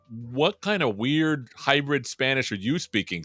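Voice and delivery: ordinary delivery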